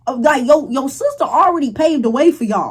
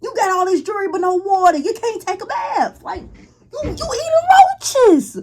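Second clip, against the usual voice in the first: high-pitched, incredulous rant; exasperated scolding; 7 semitones higher than usual. Real, not acted. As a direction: scold her with mounting disbelief, pitch climbing. Scolding the sister's spending.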